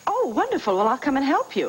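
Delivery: high, eager delight; excited gratitude. Acted. Caller offering to help search the files, excited. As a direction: leap on the offer, pitch shooting up.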